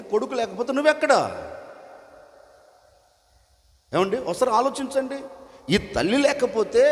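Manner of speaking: high, urgent sermon appeal; fervent preaching. Acted. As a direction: press the congregation to think, rising.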